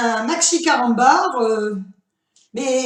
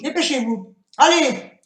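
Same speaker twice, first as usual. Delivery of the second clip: ordinary talk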